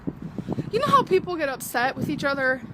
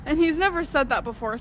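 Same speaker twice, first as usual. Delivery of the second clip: ordinary talk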